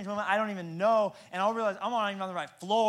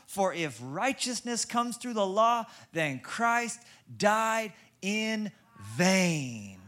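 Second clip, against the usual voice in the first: usual speaking voice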